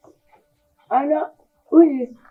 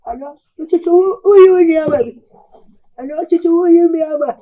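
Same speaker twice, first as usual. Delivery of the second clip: crying, louder as it breaks; tearful wailing; 3 semitones higher, 4 dB louder than usual. Acted. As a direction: sob it out to your son. Mother wailing at son's running away.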